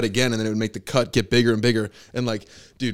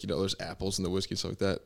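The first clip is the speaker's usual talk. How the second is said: ordinary talk, nothing marked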